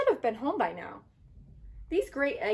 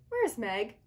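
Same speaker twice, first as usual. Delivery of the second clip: usual speaking voice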